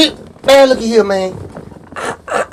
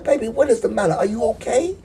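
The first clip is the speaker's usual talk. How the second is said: higher, softer phone voice; voice taken up high; 4 semitones higher, 7 dB quieter than usual. Retold or imitated. Man asking his crying girlfriend if she's okay, high soft voice. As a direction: go up high and gentle to check on her. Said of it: "I take my voice up eighteen octaves".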